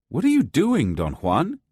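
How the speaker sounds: loud. A narrator's reading. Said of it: "I asked him in a loud voice".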